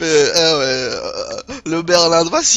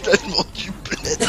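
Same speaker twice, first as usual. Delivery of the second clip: breathless laughter; 2 semitones higher, 4 dB quieter than usual. Real, not acted. Helpless laughter at the joke.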